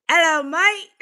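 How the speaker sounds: cheeky British greeting, high and loud with a laugh; a playful put-on British hello; laughing British "Hello, mate"; mock British accent. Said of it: "I sound British, do I?".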